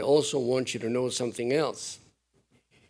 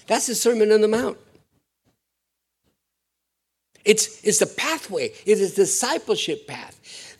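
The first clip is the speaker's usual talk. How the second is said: higher and louder, rising fervour; fervent preaching; 9 semitones higher, 6 dB louder than usual. Acted. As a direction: proclaim it to the whole congregation. Preaching climax.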